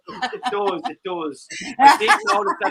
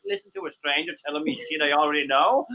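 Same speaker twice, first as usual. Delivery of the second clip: usual speaking voice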